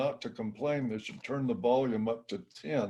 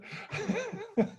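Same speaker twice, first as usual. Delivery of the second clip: high-pitched chuckle; amused high giggle; laugh; 4 dB quieter than usual. Real, not acted. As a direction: laugh at your own quip. Laughs at own joke.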